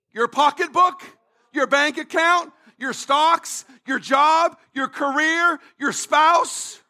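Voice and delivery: each item rising like a question; rhetorical questioning. Acted. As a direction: list them as doubtful questions, pausing between. Mock questions listing false sources of peace.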